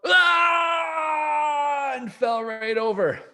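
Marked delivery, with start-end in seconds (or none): 0.1-1.9 high loud startled yell; frightened yell. Acted. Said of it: "His father yelled".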